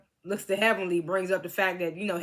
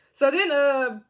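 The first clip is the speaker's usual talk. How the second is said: usual speaking voice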